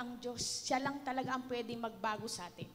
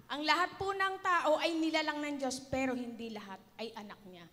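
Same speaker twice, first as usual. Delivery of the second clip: usual speaking voice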